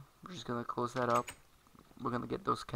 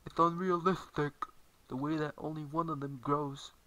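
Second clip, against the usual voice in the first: higher, mocking naysayer voice; put-on complaint, pitched up at the start; imitated complainer; 4 semitones higher than usual. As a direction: mimic a nitpicking critic. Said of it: "some people would be like".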